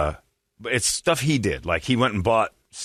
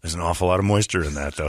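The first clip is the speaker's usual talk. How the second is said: normal talking voice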